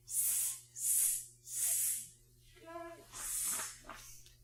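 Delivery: whispered monkey hisses; monkey hissing. A narrator's reading. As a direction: hiss like cheeky monkeys. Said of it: "and said".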